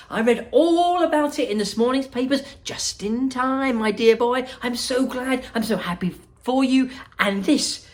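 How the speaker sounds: high, loud, delighted host; gushing Wonka. Acted. Wonka welcoming Charlie and his grandfather, high and delighted. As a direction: beam at Charlie like a thrilled showman.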